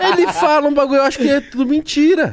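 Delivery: high squeaky teasing voice; teasing disbelief. Real, not acted.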